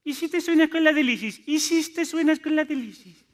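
vocecilla muy aguda y machacona; provocador burlón. Retold or imitated. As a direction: imita con soniquete infantil al que pincha. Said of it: "viene uno y te dice". (retador con voz de pito)

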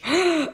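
high, sudden nervous gasp; sharp gasp. Real, not acted. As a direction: suck in a quick high breath. Waiting nervously for the hatch.

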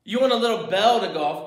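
normal talking voice

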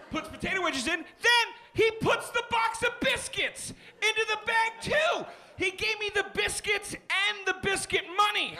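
high-pitched, shouted disbelief; incredulous outcry. Acted. Customer recounting the unwanted biscuits, incredulous outcry. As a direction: climb into an outraged high pitch. Incredulous rant about extra food.

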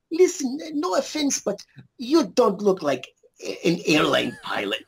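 put-on high, slow Russian accent for the German director; imitating a director with a heavy Russian accent. German director telling actor he's no pilot, Russian accent. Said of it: "I'm doing, like, a Russian accent".

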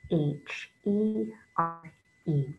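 letters named slowly, one by one; slow spelling. Shown as a demonstration. Teacher spelling a word along with children, slow and deliberate. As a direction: spell it out slowly so kids can follow. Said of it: "say the name of the letters with me".

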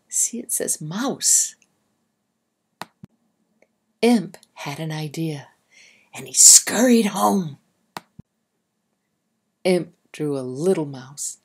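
ordinary delivery, nothing marked

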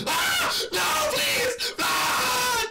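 high gasp then shouted plea; real panic at being caught; startled scream. Real, not acted. Caught and killed in game.

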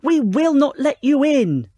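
slow, loud, high refusal; defiant shout. A narrator's reading. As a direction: stand firm, every word drawn out. Pigs refuse the wolf.

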